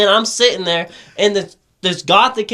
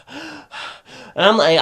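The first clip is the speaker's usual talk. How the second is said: imitated gasp; much slower than usual. High hits, can't breathe.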